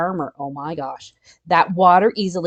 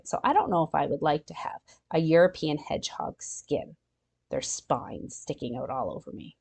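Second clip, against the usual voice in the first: musing whisper; 4 semitones lower, 8 dB quieter than usual. Real, not acted. Musing doubtfully to kids.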